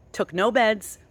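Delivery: ordinary talk, nothing marked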